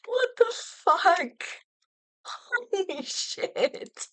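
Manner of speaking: high, very slow expletives; stunned disbelief. Real, not acted. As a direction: stretch each word out, aghast. Stunned by the team's news.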